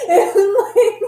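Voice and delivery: high bursting laugh; real laugh breaking into words. Real, not acted. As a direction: laugh it out, amused at yourself. Laughing at own story.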